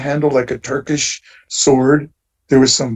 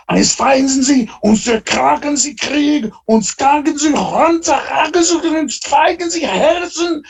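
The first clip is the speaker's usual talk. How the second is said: high, exaggerated fake German rant, much higher than his own voice; mock German gibberish; 13 semitones higher than usual. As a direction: spout nonsense German in a shrill caricature voice. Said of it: "suddenly I hear this loud German voice".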